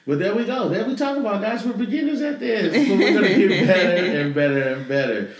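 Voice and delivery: high, loud laughing voice. Real, not acted. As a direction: laugh it off, embarrassed. Embarrassed by phone ringing.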